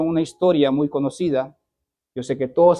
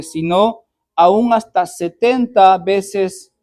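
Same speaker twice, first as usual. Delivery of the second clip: louder, measured reading of the verses; fervent scripture reading; 3 semitones higher, 7 dB louder than usual. Acted. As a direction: proclaim the passage to the congregation. Reads the Bible passage aloud.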